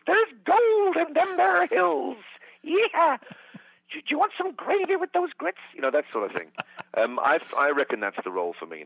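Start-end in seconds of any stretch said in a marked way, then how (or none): 0.1-5.6 put-on high, slow old prospector drawl; grizzled old-timer voice. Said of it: "I could be that old prospector who goes".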